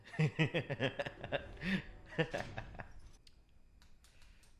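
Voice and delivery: long hearty burst of laughter; real amused laughter; laughing. Real, not acted. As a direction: laugh hard in reaction, in bursts. Laughs at the show's line.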